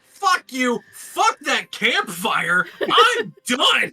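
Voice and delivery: high, loud ranting with laughter breaking in; furious quitting shout. Acted. Bandit yells he's quitting the fight, laughing shout. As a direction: rant like a bandit done with everything.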